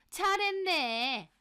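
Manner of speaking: high, drawn-out mock praise; sarcastic mother-in-law. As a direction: stretch it out with scorn. Mother-in-law's sarcastic retort.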